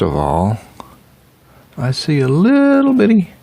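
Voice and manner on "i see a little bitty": much higher, squeaky voice for something tiny; squeaky little-bitty voice. Acted. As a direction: make it sound teeny.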